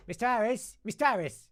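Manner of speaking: high urgent calls to the teacher; calling out. Retold or imitated. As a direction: call his name up high and loud.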